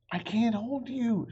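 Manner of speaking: high, loud desperate cry; high loud panicked cry. Acted. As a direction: cry out as his hands slip. Sister losing grip.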